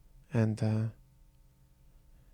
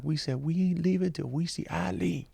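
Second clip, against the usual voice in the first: a higher put-on voice of a stubborn street guy; imitating a shelter guy; 5 semitones higher, 3 dB quieter than usual. Son recalling a homeless man's words at the parade, mild imitated voice. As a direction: lightly voice the guy who won't budge. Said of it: "a voice that I remember in my head of this one guy".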